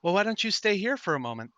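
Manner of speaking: high, eerily calm doll voice; sweet and unsettling, like a porcelain doll; too calm creepy doll. Acted. Living doll speaking to player.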